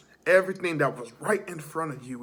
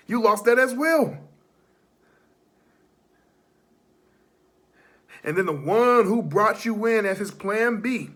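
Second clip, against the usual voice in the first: wrestling promo; 5 semitones higher, 5 dB louder than usual. Acted. Promo loud taunting recap.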